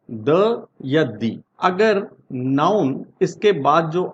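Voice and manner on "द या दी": very slow demo of "द" as a short "duh", then "दी" as a long "dee"; "द" short, "दी" long, both drawn out slowly; pronouncing the. Shown as a demonstration. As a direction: set "द" against "दी" slowly for the class. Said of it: "इसको हम कैसे pronounce करते हैं".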